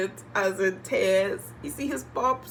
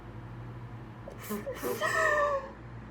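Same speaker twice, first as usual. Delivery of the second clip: crying wail; 6 dB quieter than usual. Real, not acted. Sisters brought out.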